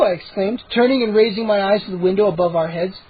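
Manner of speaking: ordinary delivery, nothing marked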